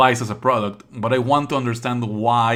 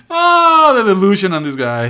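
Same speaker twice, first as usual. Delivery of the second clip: much higher, louder incredulous exclaim; amused outburst; 8 semitones higher, 10 dB louder than usual. Real, not acted. Streamer to chat about the character, loud incredulous outburst. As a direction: blurt it out in disbelief. Reacting to character's war speech.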